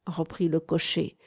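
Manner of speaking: ordinary delivery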